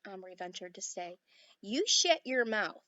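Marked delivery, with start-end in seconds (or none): 1.6-2.8 slow, loud Texas cowboy shout. A narrator's reading. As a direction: bellow it slowly in a Texas drawl. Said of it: "shouted the little man".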